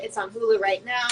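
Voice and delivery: normal talking voice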